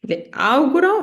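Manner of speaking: "auguro" with the A stressed and lifted. Shown as a demonstration. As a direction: hit the first A in "auguro" clearly. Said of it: "fate cadere l'accento sulla A".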